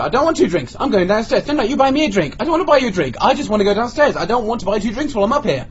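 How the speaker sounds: high-pitched bickering retold; exasperated mock protest. Customer haggling with waitress over drinks, exasperated protest. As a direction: argue back and forth, voice pushed up high.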